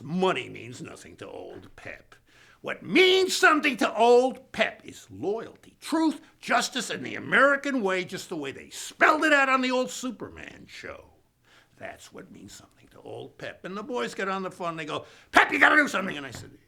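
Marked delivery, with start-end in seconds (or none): 2.6-11.1 louder, higher, pompous patriotic speech; grand proclamation. Acted. 15.3-16.2 loud, high, mock-urgent demand; urgent plea. Retold caller pleading.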